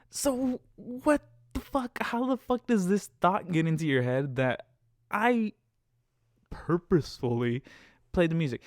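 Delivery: high, incredulous outburst; exasperated demand. Retold or imitated. Driver questioning the passenger's accusation, exasperated demand. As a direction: drag out the opening in exasperation.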